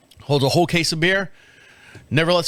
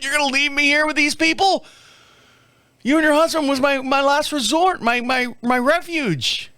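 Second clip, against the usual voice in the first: mock pleading; 11 semitones higher than usual. Pleading with neighbor, mock despair.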